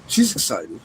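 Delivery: ordinary delivery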